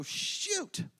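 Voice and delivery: very high, soft exasperated shoot; a sudden retold cry of frustration; retold gaming outburst; frustrated yelp. Messing up video game.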